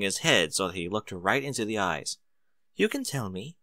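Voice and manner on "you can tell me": soft, coaxing reassurance; gentle. Acted. Rarity softly coaxing Spike to confide, gentle. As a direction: coax the secret out gently.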